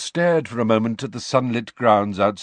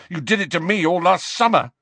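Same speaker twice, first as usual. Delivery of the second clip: high, heated accusation; furious; 10 semitones higher, 4 dB louder than usual. A narrator's reading. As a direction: hurl the grievance at him furiously. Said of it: "Harry said furiously".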